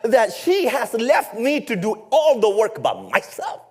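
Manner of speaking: high, sharp exasperated snap at Jesus; a sister's sudden indignant outburst; snapping complaint. Acted. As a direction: lash out, fed up and overworked. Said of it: "she suddenly snaps".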